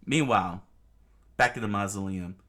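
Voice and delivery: plain reading voice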